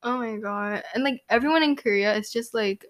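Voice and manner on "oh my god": usual speaking voice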